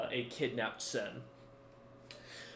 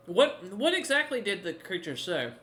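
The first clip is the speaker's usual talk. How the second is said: higher-pitched, puzzled demand; baffled, pitched-up query; incredulous questioning; 7 semitones higher, 3 dB louder than usual. Acted. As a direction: question it in mild disbelief. Bard questions a baffling claim.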